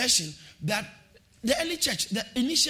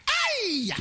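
joyful whoop; 6 dB louder than usual. Real, not acted. Celebrating being justified.

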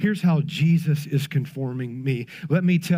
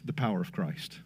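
much lower and far softer, almost breathed; hushed reverence; 11 semitones lower, 13 dB quieter than usual. Real, not acted. Preacher telling the church what he found, in a reverent hush. Climax of a point.

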